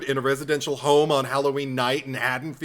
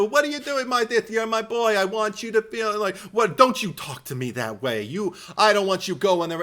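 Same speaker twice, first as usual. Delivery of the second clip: higher smothering mother in mock Brooklyn accent; Brooklynese Mrs Bates; 6 semitones higher, 3 dB louder than usual. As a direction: coo then scold like an overbearing mother. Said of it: "a Brooklynese Missus Bates".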